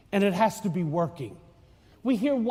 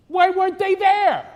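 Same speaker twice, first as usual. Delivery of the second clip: high, loud disbelieving question; incredulous; 9 semitones higher, 4 dB louder than usual. Real, not acted. Priest asking the congregation, incredulous and high. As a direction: ask it in disbelief.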